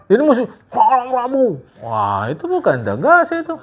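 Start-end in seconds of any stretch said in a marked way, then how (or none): 0.1-1.5 higher, louder, forceful insistence; harsh and loud. Shown as a demonstration. Preacher demoing a harsh answer instead of a firm one. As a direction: bark it like you won't be contradicted.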